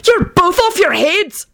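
high, drawn-out panicky cry; alarmed high-pitched protest; panicked yell. A narrator's reading. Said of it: "Benji yelled with a panicked expression".

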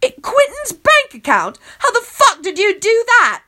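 high, loud, stunned outburst; shocked cry at the bank hack; blurting out. A narrator's reading. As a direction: blurt it in disbelief. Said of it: "I blurt out".

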